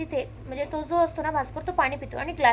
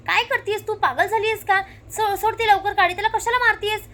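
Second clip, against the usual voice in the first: higher, louder sharp rebuke; raised, exasperated telling-off; angry scolding; 5 semitones higher, 4 dB louder than usual. Retold or imitated. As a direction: scold her to stop right now. Damini scolds Savi sharply.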